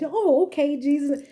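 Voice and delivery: high, mock meek okay; hammed-up meek Peter, high; meek agreeing Peter. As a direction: play the too-easy yes.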